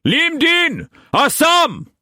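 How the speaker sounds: high, loud bellow of names. A narrator's reading. Struan summoning the trembling servants, loud bellow. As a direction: bellow for the servants across the house. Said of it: "he bellowed".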